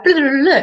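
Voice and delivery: mock French babble. Vlogger imitating chats with her friend, mock French babble. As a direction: babble as if speaking casual French. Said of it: "in French".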